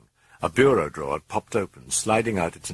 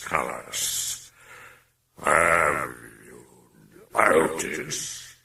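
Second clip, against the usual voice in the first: low taunting demon; 3 semitones lower, much slower than usual. Acted.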